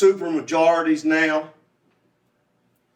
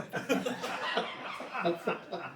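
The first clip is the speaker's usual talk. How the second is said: soft, high chuckle; laugh; 6 semitones higher, 11 dB quieter than usual. Real, not acted. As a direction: chuckle softly at your own joke. Laughs at his own self-deprecating joke.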